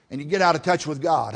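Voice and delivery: usual speaking voice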